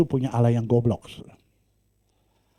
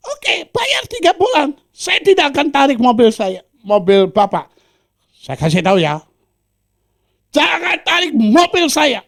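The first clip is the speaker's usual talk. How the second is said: much higher, loud put-on voice of the collector; debt collector; 14 semitones higher, 9 dB louder than usual. Debt collector arguing with the preacher, loud and high.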